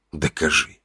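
low, soft echo to oneself; clenched-jaw inner thought. A narrator's reading. Traveller repeats the challenge inwardly, low and tense. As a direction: mull it over quietly through a tight jaw. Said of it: "подумал я, сжимая челюсти".

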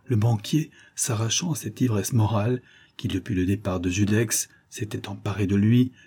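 usual speaking voice

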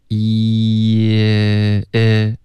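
long held "一" easing down into "e"; vowel sliding down. Shown as a demonstration. Teacher shows learners the short I, drawn-out slide from "一" to "e". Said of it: "慢慢地降下来".

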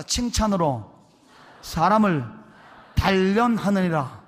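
loud, measured scripture recital in separate phrases; lead repeat-after-me. Shown as a demonstration. Pastor leading the congregation through a Proverbs verse, loud recital. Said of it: "한번 따라합시다".

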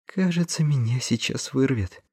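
high-pitched, pitiful whine; queasy, plaintive little voice. A narrator's reading. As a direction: whimper it as if about to be sick. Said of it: "жалобно проговорил Хэммик".

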